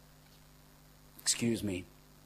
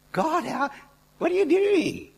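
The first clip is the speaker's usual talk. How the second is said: very high, loud outcry to God; exasperated protest; 12 dB louder than usual. Retold or imitated. Offended minister questioning God mid-prophecy, exasperated high cry. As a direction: wail it upward in mock outrage.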